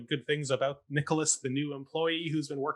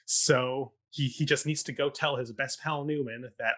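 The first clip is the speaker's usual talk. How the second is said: ordinary delivery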